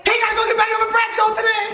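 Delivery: very high doll voice; Bratz doll voice. Pretend. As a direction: squeak it out like a talking doll. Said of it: "your best [name] Bratz doll impression?".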